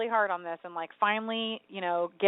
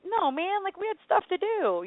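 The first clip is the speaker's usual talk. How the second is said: mock whine; 12 semitones higher than usual. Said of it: "I was like".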